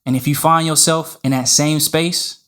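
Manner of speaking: scripted podcast intro. Acted. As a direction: deliver a punchy podcast opening in measured phrases.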